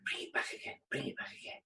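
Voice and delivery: very soft, whispered refrain; hushed repetition. Real, not acted. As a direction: whisper it, almost to yourself. Repeats her point in hushed voice.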